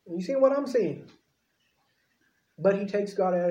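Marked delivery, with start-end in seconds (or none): 0.1-1.1 puzzled disbelief. Retold or imitated. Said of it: "scratching his head".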